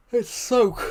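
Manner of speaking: teeth-chattering gasp, high and loud; shivering from cold. Real, not acted. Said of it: "oh my god, it's so cold".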